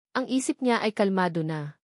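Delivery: plain reading voice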